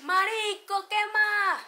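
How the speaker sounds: high, loud hey-buddy shout; young guy's shouted greeting. Retold or imitated. Young Venezuelan guy greeting a friend, loud and high. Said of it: "los nuevos es".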